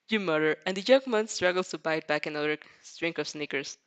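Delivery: usual speaking voice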